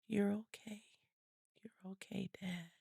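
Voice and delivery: soft, reassuring whisper-quiet voice; a little girl calming her dad; imitating daughter's gentle comfort; soothing child.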